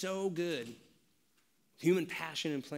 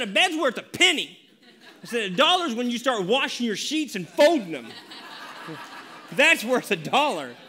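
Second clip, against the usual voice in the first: higher, louder, laughing through it; laughing dad; 6 semitones higher, 8 dB louder than usual. Retold or imitated. Father laughing while haggling with his son over chores. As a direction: laugh as you haggle with your boy.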